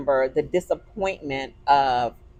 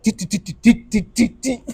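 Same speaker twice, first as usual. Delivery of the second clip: car warning chime. Retold or imitated. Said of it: "I was like".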